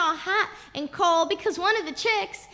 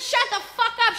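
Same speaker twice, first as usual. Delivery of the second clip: raised, loud comeback; angry retort; 6 semitones higher, 5 dB louder than usual. Retold or imitated. Father silences mother mid-beating, angry shout. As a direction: cut her off loudly. Father snaps back in quarrel.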